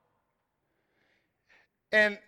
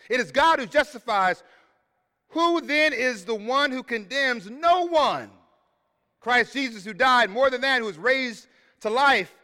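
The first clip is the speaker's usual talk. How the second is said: louder, rising, emphatic reading; louder, measured pulpit reading; scripture preaching; 3 semitones higher, 4 dB louder than usual. Acted. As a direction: proclaim the verses with force.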